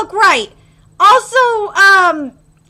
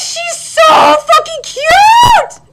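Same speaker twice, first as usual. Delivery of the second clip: ecstatic fan squeal; 4 dB louder than usual. Real, not acted. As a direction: squeal over a beloved character's arrival. Favourite character appears on screen.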